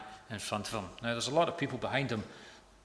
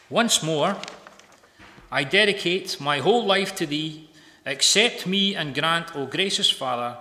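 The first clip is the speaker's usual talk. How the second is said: fervent prayer reading; 6 dB louder than usual. Acted. Preacher reading Livingstone's dedication prayer, fervent then subdued. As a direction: pray it out boldly, easing down to the signature. Reads journal prayer aloud.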